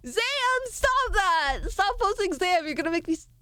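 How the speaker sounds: high-pitched outburst at a chatter; exasperated scolding. Real, not acted. Streamer telling a chatter to stop posting, high exasperated scolding.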